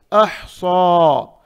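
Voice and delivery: अहसा with a thick emphatic s, drawn out; heavy saad. Shown as a demonstration. Teacher modelling emphatic saad, slowly. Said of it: "सौद भी मोटा पढ़ेंगे".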